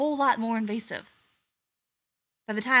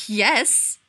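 snorted; 8 dB louder than usual. A narrator's reading. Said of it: "McKay snorted".

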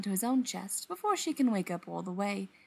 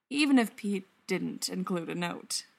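ordinary delivery

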